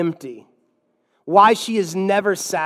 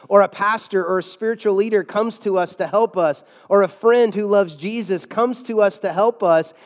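usual speaking voice

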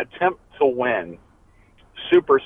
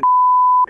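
sharp high laugh; 6 dB louder than usual. Real, not acted. Caller laughs instead of threat.

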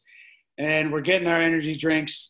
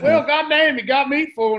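high, loud mock good-old-boy; loud roofer impression; 11 semitones higher, 7 dB louder than usual. Imitating a roofer conceding to a huge stranger, high and loud. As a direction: crow it like a cocky construction guy.

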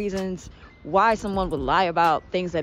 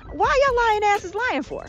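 high, incredulous exasperation; exasperated outburst; 12 semitones higher than usual. Real, not acted. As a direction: throw up your hands at them.

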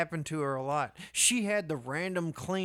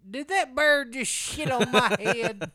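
imitated incredulous grandmother; 7 semitones higher, 4 dB louder, slower than usual. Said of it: "And she said".

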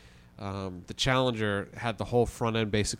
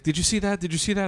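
imitating a panicked man, pitched much higher; freaked-out man; 9 semitones higher, faster than usual. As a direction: play the shaken crash driver, voice up high. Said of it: "He's freaked out".

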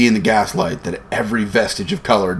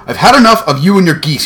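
high, loud outburst of a fed-up salesman; angry shout; 9 semitones higher, 6 dB louder than usual. A narrator's reading. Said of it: "he shouted".

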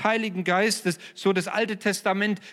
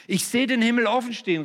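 a touch of a higher, louder call, Stephanus seeing heaven; mild exclaim; 6 semitones higher, 3 dB louder than usual. Retold or imitated. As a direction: lift it into a rapt cry of wonder. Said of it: "rief er".

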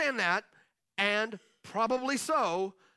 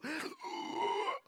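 a sharp higher gasp of disbelief; mock shocked gasp; 6 semitones higher, 6 dB quieter than usual. Parent seeing the kids fight, a mock gasp. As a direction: gasp as if caught off guard.

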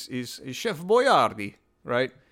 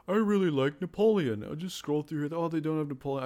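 higher-pitched voice of a browsing listener; light, high put-on listener voice; imagined listener; 5 semitones higher than usual. Would-be listener browsing the episode list, high put-on voice.

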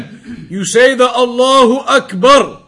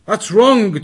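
usual speaking voice

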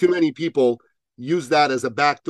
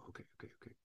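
whispered aside; 36 dB quieter, much faster than usual. Retold or imitated. His inner reaction, hushed.

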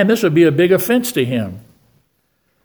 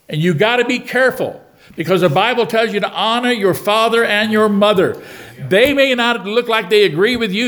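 preaching; 5 semitones higher than usual. Acted. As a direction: exhort the church, drive each point home. Preaching at congregation.

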